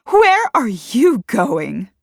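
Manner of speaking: loud, slow, high demand; shouted demand. A narrator's reading. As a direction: demand an answer, drawing it out. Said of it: "someone shouted behind them".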